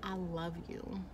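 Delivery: slow, hushed I love you; soft tender confession. Retold or imitated. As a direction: breathe it softly and slowly. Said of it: "looks me dead in my face and was like".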